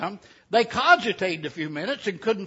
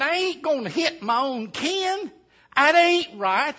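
indignant refusal; 7 semitones higher than usual. Acted. Digger told to hit his cousin's hand, protesting.

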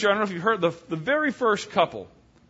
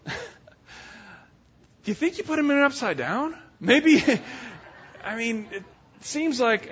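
teasing laugh; 5 semitones higher than usual. Retold or imitated. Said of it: "she says to her husband".

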